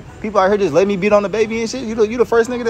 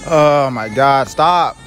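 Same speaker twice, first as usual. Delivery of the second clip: loud, slow, lowered groaning plea; feigned exasperation; 5 semitones lower, 8 dB louder, much slower than usual. Pretend. Father worn down by a crying baby, loud mock exasperation. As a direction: drag it out loudly, at wit's end.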